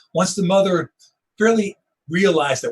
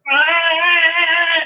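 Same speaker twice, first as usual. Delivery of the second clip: loud, high sustained animal bleat; steenbok's cry; 13 semitones higher, 7 dB louder than usual. Retold or imitated. Photographer imitates the young antelope caught by the leopard, loud bleat. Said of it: "the steenbok is kinda going".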